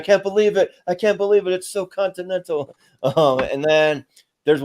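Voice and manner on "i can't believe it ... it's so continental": mock freaking out. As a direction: act out giddy hotel-guest disbelief. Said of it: "he's, like, freaking out".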